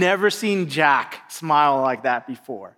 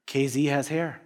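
put-on low, soft knowing hint to the kids; hushed hint; 5 semitones lower, 8 dB quieter than usual. Pastor hinting to the kids who he is, hushed and soft. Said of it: "and I'd be like".

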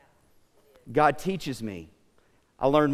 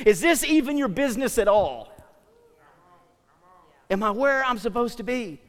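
louder, higher, pressing preacher's plea; impassioned preaching; 6 semitones higher, 5 dB louder than usual. Acted. Preacher confessing busyness to God before the congregation, impassioned preaching. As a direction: preach it as an urgent appeal to God.

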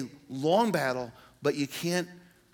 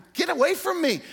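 sharp, much higher, louder shout pushing someone off; get away from me; 14 semitones higher, 7 dB louder than usual. Pretend. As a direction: bark it like an intruder poked your eye. Pretend someone pokes your eye.

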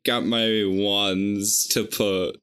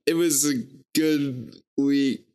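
ordinary talk, nothing marked

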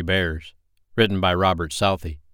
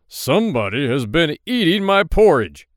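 big gruff bear booming loudly, pitch raised; loud, gruff growl of an outraged father bear; gruff Papa Bear; 8 semitones higher, 7 dB louder than usual. A narrator's reading. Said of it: "said in his big, gruff voice".

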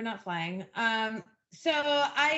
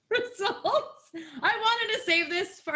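laughing; 7 semitones higher, 4 dB louder than usual. Real, not acted. Laughs through the plot summary.

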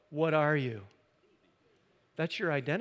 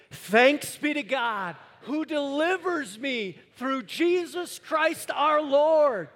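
loud, high, slow proclamation; loud with conviction; 11 semitones higher, 11 dB louder, slower than usual. Shown as a demonstration. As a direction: shout it out with full conviction. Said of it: "say it with conviction and say it loudly".

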